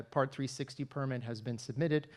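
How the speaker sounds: normal talking voice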